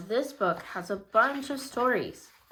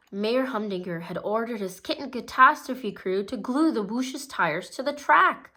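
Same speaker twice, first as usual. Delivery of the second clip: ordinary delivery, nothing marked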